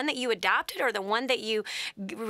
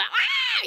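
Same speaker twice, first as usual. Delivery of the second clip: high-pitched imitation of a screaming baby; piercing scream. Mother imitating her newborn's response to everything, piercing scream. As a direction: let out a sudden mock scream, high and sharp. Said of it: "piercing, loud scream".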